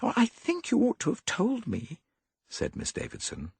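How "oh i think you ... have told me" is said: put-on high, light young woman pleading; higher girlish voice, resigned; young woman's voice. A narrator's reading. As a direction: voice Molly as a worried young lady. Molly voiced.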